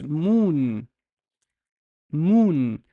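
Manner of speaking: "moon" with a long, held oo; one long syllable "moon", oo drawn out; long vowel demo. Shown as a demonstration. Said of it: "There's a fatha here, so it's".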